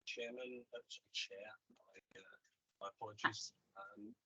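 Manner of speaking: faint and distant, like down a tunnel; very quiet. Real, not acted. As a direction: far from the mic, barely audible. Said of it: "Alan, you sound very quiet".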